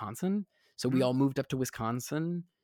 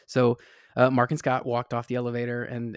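ordinary talk, nothing marked

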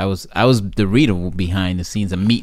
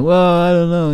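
mock reluctance; 9 semitones higher, 4 dB louder than usual. Hesitant executives voiced in story.

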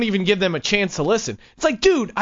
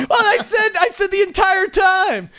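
exasperated outburst; 14 semitones higher than usual. Retold or imitated. Speaker protests to his doubting brother, exasperated yell. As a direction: wail it like nobody ever listened.

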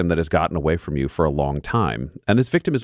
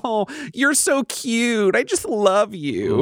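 much higher, slow sing-song mockery; mocking killer girl; 14 semitones higher, slower than usual. Sydney taunting Bloody Mark, high slow mock sweetness. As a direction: coo at him like he's a toy. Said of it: "she just starts laughing maniacally".

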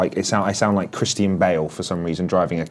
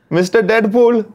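high, drawn-out mock Indian accent; 13 semitones higher, 4 dB louder, slower than usual. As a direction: exaggerate an Indian cab driver's accent, slow and high-pitched. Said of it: "And it is the stereotypical Indian".

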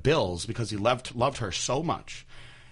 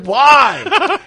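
loud, high incredulous why; shocked why; 14 dB louder than usual. Retold or imitated. Son shocked at mom's story, loud high why. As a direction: yelp it in disbelief. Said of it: "And I was like".

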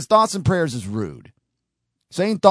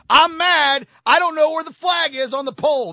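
hammed-up mad outburst, much higher and louder; mock anger; 11 semitones higher, 3 dB louder than usual. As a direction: play at being furious, over the top. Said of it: "I'm mad now".

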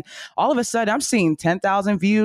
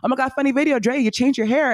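higher-pitched, gushing follower voice; imitated excited fan; 8 semitones higher than usual. Guest quoting followers who notice her, mock excited. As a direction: gush like a follower who feels like a friend.

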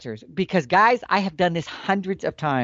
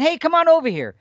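high friendly call to a buddy; 11 semitones higher than usual. Retold or imitated. Man calling best friend over, high friendly call. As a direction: wave your friend over with a raised call. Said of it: "just like if you said".

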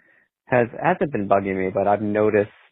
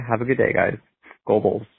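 ordinary talk, nothing marked

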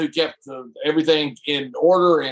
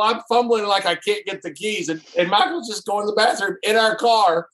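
normal talking voice

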